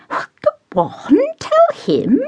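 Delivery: high coaxing push; insistent. Acted. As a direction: urge him on, high and eager. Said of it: "she insisted".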